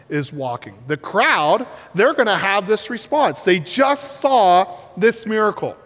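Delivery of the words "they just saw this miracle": ordinary talk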